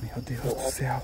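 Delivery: slow, hushed, shaken prayer under the breath; scared hush. Real, not acted. Ghost hunter spooked by demon voices, slow hushed dread.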